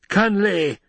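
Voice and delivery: sudden high-pitched cry; acted shout, pitch leaping up; shouted challenge. A narrator's reading. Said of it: "Feyd-Rautha shouted".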